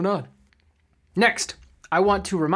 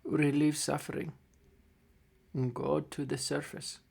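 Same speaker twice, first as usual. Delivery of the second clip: somber game master narration; 3 semitones lower, 6 dB quieter than usual. A narrator's reading. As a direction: narrate the death scene gently. Game master narrates dying gnome.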